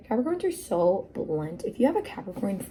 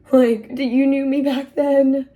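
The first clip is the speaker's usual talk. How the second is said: higher, louder, slow amazed question; stunned disbelief; 7 semitones higher, 11 dB louder than usual. Retold or imitated. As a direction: ask it as if you can't believe it. Her own stunned reply.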